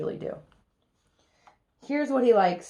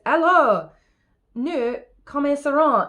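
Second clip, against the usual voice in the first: French phrase, raised and insistent; tutor's testy opening in French; lightly voiced exasperated tutor calling the lesson to begin; angry tutor; 6 semitones higher, 4 dB louder than usual. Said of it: "Here's what makes Philip angry".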